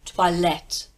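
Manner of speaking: toilette as twa-LET; French toilette. Shown as a demonstration. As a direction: toilette with a wa sound. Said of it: "So you say toilette".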